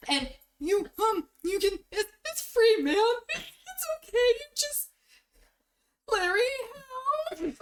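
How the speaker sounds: nervous dwarf, voice climbing high; scared squeak. Acted. Shy dwarf pleading with Larry for help, panicky high squeak.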